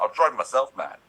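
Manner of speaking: worked-up self-annoyance; mock exasperation. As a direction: complain loudly at yourself. Retold frustration at hearing himself.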